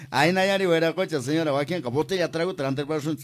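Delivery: cheering across the fiesta; festive call-out. Real, not acted. Host calls out festive greeting.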